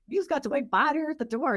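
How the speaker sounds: high mock plea to her husband; high mock exasperation. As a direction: squeak it out in disbelief. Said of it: "I'm like".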